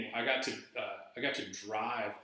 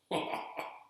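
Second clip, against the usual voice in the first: breathless laugh. Real, not acted. Laughing at the friend's habits.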